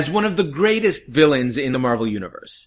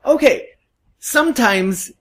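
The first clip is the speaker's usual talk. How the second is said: high, tight exasperated voice; barely keeping a lid on it; holding back a shout; 10 semitones higher, 5 dB louder than usual. Real, not acted. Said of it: "I'm trying not to scream".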